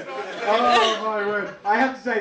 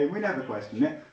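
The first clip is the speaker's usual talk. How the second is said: usual speaking voice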